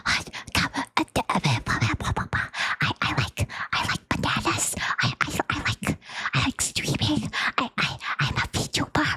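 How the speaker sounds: breathy mock ASMR whisper. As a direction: imitate a stuttering ASMR whisperer. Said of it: "I don't like it when people go".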